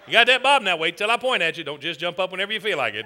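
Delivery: usual speaking voice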